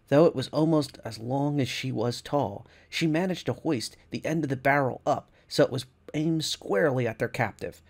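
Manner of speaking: ordinary delivery, nothing marked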